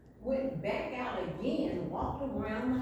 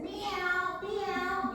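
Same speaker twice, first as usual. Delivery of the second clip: high meow; cat meowing; 7 semitones higher than usual. Acted. Cat answers the boy with a high meow. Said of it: "And the cat said".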